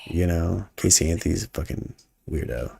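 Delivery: a whispered aside, then low and soft; hushed aside. Real, not acted. Trading gossip about Casey Anthony in a whisper. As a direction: lean in and murmur it like gossip.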